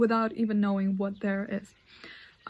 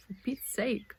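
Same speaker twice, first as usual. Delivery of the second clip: whispered grumble; 5 dB quieter, slower than usual. Real, not acted. Burnt hand, muttered.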